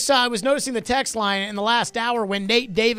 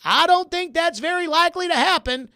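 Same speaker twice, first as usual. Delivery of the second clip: higher-voiced impersonation of a sportswriter; lightly put-on, higher impression voice; Jim Wyatt; 6 semitones higher than usual. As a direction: lift the pitch and play the columnist answering. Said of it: "That was a very good Jim Wyatt impersonation".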